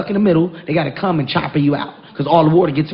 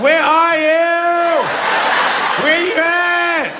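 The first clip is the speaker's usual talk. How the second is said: much higher, slow, drawn-out teasing; mock lifeguard taunt; 8 semitones higher, slower than usual. Husband taunts his struggling wife with the guide's line, slow mock. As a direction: drag it out like a smug rafting guide. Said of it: "I'm like".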